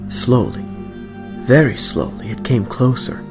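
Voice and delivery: usual speaking voice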